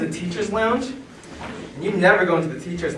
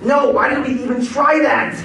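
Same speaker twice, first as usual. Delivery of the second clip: higher, louder exasperated complaint; exasperated whine; 7 semitones higher, 4 dB louder than usual. Retold or imitated. Hurt after the fall, complaining to his friend. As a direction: whine about the stupid idea.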